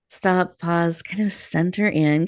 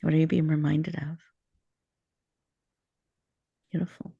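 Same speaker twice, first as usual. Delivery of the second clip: guided meditation. Acted. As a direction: ease listeners into stillness, gently. Host leads a guided meditation.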